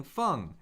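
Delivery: "feng" falling from high; fourth tone. Shown as a demonstration. Teacher cycling four tones on a syllable, "feng" falling. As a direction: start "feng" high and drop it quickly. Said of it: "Let's do the four tones".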